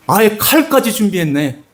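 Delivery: ordinary delivery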